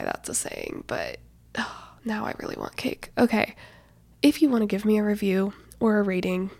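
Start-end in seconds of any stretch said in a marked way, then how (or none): 0.0-3.1 whispering. Real, not acted. Whispered aside about cake.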